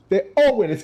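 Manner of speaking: high, lighter repeat of the cheer. Pretend. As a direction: toss it off cheerfully. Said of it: "Give them a round of applause".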